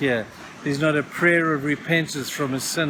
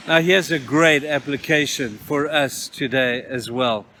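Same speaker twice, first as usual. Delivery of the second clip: ordinary talk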